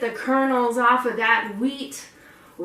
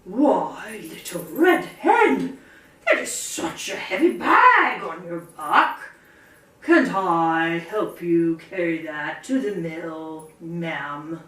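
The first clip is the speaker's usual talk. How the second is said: a lightly lower, slow courtly horse; kindly horse; 5 semitones lower, slower than usual. Acted. Horse offers to carry bag, slow kindly voice. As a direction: offer help like a gentle gentleman. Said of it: "he went".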